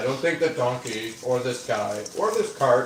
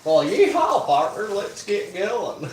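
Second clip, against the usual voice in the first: cowboy voice; 5 semitones higher, 3 dB louder, slower than usual. Pretend. Mock cowboy reply to driver.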